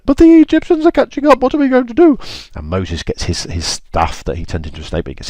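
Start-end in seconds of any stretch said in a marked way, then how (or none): 0.1-2.2 very high squeaky panic; a high squeal of alarm at being chased; panicked Israelites. Acted.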